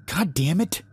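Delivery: high-pitched, dismayed outburst; lamenting. A narrator's reading. Larry rushes up to the opened door, lamenting. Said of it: "Larry lamented as he rushed up".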